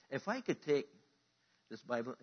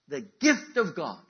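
emphatic proclamation; 7 semitones higher, 15 dB louder, slower than usual. Acted.

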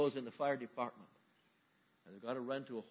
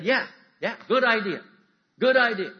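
loud, high, eager agreement; eager crowd; 8 semitones higher, 15 dB louder than usual. Acted.

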